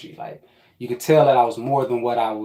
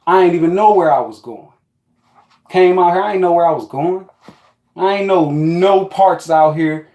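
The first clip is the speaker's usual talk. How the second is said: ordinary talk, nothing marked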